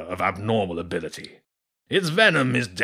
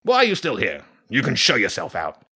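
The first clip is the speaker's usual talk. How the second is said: grumpy; 3 dB louder than usual. Acted. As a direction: shoo the girl out gruffly. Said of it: "the badger said grumpily".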